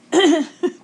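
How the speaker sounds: a burst of high, loud laughter. Real, not acted. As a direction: laugh out loud at your own story. Laughing at her funny stories.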